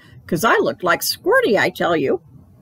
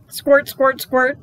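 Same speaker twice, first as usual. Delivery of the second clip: playful pretend squirting noise; 6 semitones higher, much slower than usual. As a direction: make the squirt sound.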